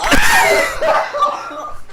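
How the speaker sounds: real laughter at a creepy thumbnail; shrieking laugh. Real, not acted. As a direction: howl with laughter.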